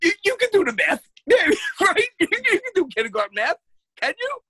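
high, fast, loud teasing; mocking tease. Real, not acted. Teasing his friend who claims he can handle kindergarten math, mocking. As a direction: needle him about kindergarten math.

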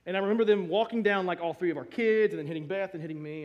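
usual speaking voice

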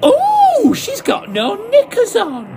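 very high, loud scandalised squeal; shocked shriek. Acted. Drunk actor spotting Denise, shrieking in shock. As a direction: gasp at the scandal.

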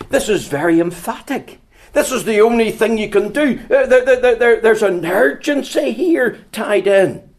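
loud, high, urgent pulpit push; preaching at full force. Acted. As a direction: drive the urgency home to the congregation.

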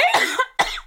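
sputtering cough from a drink; choking cough. Real, not acted. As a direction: cough hard on gin gone down wrong. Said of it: "Wait, are you okay?".

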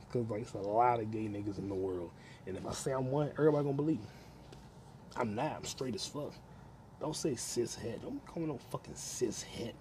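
ordinary delivery

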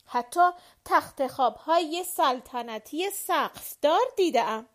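loud, high indignant shout; furious loud retort; shouting back. A narrator's reading. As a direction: roar back at the insult to his castle. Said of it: "در جواب فریاد زد".

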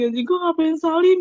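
high, rising cry of regret; mortified outburst. Retold or imitated. Student replaying the mistake on the way to class, rising mortified outburst. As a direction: let the regret burst upward in pitch.

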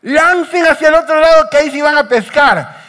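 high, loud call across the water; sustained carrying shout; shouting instructions. Retold or imitated. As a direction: holler the order from the shore, stretching the phrases. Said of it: "grita y dice".

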